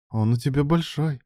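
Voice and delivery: high, mildly alarmed exclamation; crying out. A narrator's reading. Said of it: "вскрикнула женщина".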